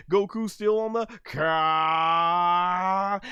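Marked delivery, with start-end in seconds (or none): 1.2-3.2 drawn-out Ka; stretched Ka. Retold or imitated. Mock Goku charging, long slow Ka. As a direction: hold the Ka slow like the anime.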